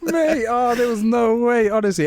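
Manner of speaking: high, loud, cracking up; laughing disbelief. Real, not acted. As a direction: exclaim it through real laughter. Cracking up over absurd price.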